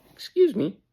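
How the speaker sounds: high-pitched "excuse me"; pointed interruption. Acted. Fed-up person interrupting a gaslighter, pointed and high. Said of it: "gonna just say".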